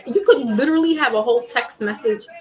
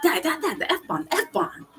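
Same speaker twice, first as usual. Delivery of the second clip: urgent hushed warning; 7 semitones higher, 4 dB quieter than usual. Retold or imitated. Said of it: "I would have my little ones in the car and be like".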